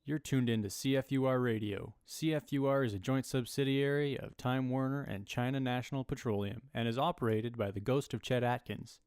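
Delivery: ordinary delivery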